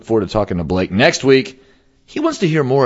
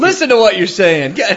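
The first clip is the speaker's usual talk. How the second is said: laughing; 11 semitones higher, 4 dB louder than usual. Real, not acted. Laughing at friend's joke.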